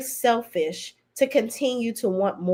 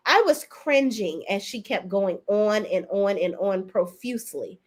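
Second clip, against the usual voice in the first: ordinary delivery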